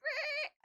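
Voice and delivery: high-pitched squealing tire noise; imitated skid squeal; tire screech imitation. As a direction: screech like tires pulling away. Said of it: "I screeched the tires".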